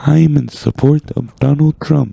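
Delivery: high-pitched synthetic computer-voice impression; Stephen Hawking. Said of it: "your guys' Stephen Hawking".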